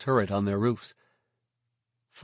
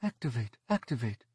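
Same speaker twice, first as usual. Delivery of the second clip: quiet, muffled, from another room; faint muffled voice; 3 semitones higher, 7 dB quieter than usual. A narrator's reading. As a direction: call through a wall. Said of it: "a faint muffled voice".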